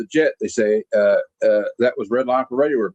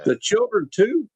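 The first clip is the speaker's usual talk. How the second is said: shocked question; 7 semitones higher, slower than usual. Retold or imitated. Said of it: "He's like".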